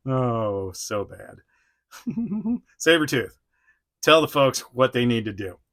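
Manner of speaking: usual speaking voice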